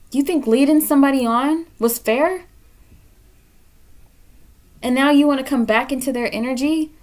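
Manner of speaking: real indignant lecture; angry scolding. Real, not acted. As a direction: tear into them for leading someone on. Reader scolds viewer for unfairness.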